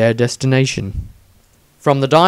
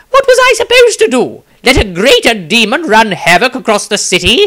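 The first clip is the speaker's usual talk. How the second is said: much higher, loud and fast indignant retort; defensive; 11 semitones higher, 7 dB louder, faster than usual. Acted. Wizard justifies himself to Dieter, high, fast and indignant. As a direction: fire back, defending your choice. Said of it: "replied defensively and added".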